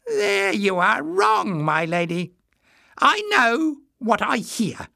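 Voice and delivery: slow, high-pitched spiteful retort. A narrator's reading. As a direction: correct them slowly in a high, smug voice. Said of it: "darted a spiteful look".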